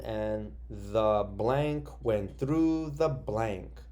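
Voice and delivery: reading the sentence slowly to the class; each word laid out slowly; slow read-aloud. Shown as a demonstration. Teacher reads the blank sentence aloud slowly. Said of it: "let's read this one".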